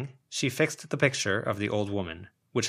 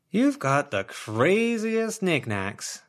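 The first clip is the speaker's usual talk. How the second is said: drawn-out marvel; 9 semitones higher, 4 dB louder, much slower than usual. Acted. Sharon marvelling, slow and high.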